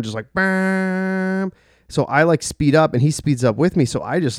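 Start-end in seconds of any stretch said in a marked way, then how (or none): 0.3-1.5 long, high held car-horn blare; sustained honk noise made with the voice; imitated car horn. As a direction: mimic someone leaning on a horn. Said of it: "holding the horn down, just laying on the horn".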